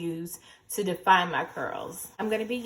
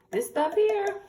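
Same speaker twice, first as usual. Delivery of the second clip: excited pitch; 13 semitones higher, 5 dB louder, slower than usual. Real, not acted. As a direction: hype it up, much higher and louder. Hyping edge control.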